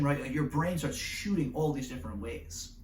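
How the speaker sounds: ordinary talk, nothing marked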